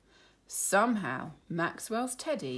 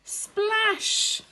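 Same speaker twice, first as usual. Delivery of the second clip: splashy; 7 dB louder than usual. A narrator's reading. Narrator sound word.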